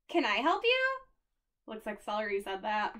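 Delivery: usual speaking voice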